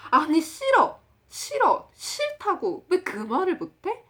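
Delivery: very high, loud exasperated friend; 답답해서 폭발. Retold or imitated. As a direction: act out a friend drilling the refusal in frustration. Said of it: "진짜 너무 답답해하면서". (너무 답답해하며 높고 크게)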